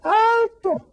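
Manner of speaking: very high shouted stop; a girl's piercing call to halt. A narrator's reading. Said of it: "gritó la niña".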